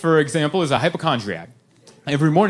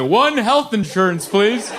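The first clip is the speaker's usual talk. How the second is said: slow, higher mock-naive request; like an idiot; 6 semitones higher, slower than usual. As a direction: imitate yourself ordering like a clueless caller. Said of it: "I called like an idiot".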